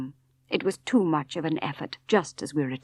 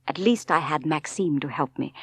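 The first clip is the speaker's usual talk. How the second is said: plain reading voice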